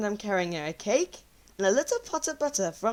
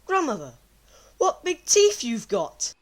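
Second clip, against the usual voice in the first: amazed exclamation. Acted. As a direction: cry it out in alarm. Girl exclaims at wolf.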